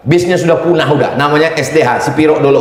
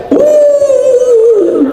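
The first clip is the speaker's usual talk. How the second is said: high pitying groan; a high, drawn-out groan of pity; mother's pitying sigh. Retold or imitated. Mother visiting her son, a high pitying groan. Mother sighs at son's room.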